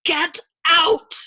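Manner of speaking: very loud, high scream; hysterical yell. A narrator's reading. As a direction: scream each word to drive him away. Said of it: "Yossarian yelled hysterically".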